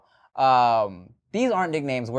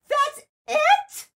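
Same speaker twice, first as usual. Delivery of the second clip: much higher, laughing disbelief; mock girl voice; slower than usual. Imitating the girl mocking his quick finish, high. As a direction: squeak it out like a stunned girl.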